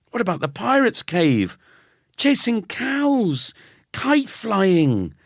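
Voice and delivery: plain reading voice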